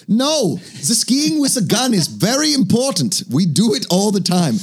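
high-pitched hammed-up Norwegian accent. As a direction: play an indignant Norwegian with a deliberately bad accent. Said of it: "a couple Norwegian listeners out there being like".

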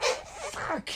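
sudden high outburst. Retold or imitated. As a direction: pitch leaps way up on fuck. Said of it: "And he'd get back to normal voice".

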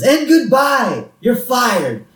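high, drawn-out mock dismissal; Mister Burns. Boss suddenly firing the nervous worker, hammed-up gleeful villain.

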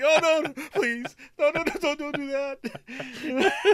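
mock pleading. Friend acting out how to fake being scared of the supervisor, in a high, very slow, laughing mock plea. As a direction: beg her not to, playing the helpless victim. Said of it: "You should have been like".

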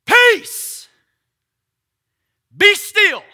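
very high, very loud command; commanding shout. Acted. Jesus standing up in the boat, shouting at the storm. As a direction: roar it over the storm.

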